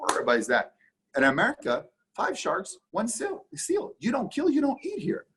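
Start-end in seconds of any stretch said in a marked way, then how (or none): 2.2-5.3 excited pitch climb. Real, not acted. Animated seal-and-shark image.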